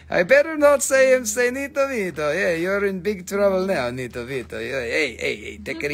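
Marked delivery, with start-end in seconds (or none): 0.1-2.3 playful sales pitch in a higher put-on voice; put-on seller voice. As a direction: ham up a pushy gem saleswoman.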